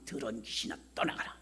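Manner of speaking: low, soft stern command to a spirit; hushed exorcism rebuke; inner rebuke. Retold or imitated. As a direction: rebuke the demon under your breath. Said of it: "속으로".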